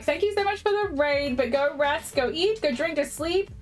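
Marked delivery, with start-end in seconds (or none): none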